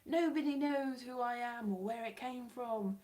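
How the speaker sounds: usual speaking voice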